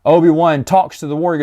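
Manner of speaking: ordinary talk, nothing marked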